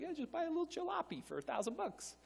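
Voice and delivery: high, soft offhand voice; light and quiet, bargaining gently; breezy suggestion. Acted. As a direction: toss out a casual, high-pitched proposal. Voices God's casual suggestion.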